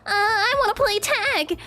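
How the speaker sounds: lightly voiced eager child; little girl. Imitating the girl asking to play tag. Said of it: "she's like".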